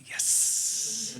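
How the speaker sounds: tiny breathy triumphant yes, barely voiced; whispered yes. Dad silently celebrating hearing it's a boy, whispered. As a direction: keep the win secret, just breathe it.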